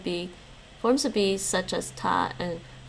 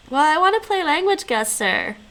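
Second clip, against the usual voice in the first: excited; 7 semitones higher, 6 dB louder than usual. Real, not acted.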